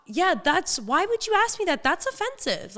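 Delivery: high-pitched offended retort; indignant. Retold or imitated. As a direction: sound insulted and incredulous. Retold indignant answer.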